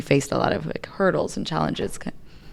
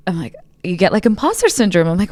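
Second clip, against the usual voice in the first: ordinary delivery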